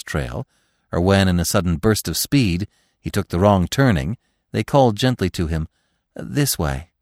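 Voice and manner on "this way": ordinary delivery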